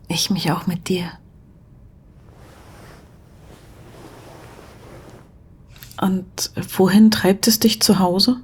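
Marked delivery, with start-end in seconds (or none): 0.1-6.5 soft whisper. Acted. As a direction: whisper it close, unhurried. Intimate whispered reply and question.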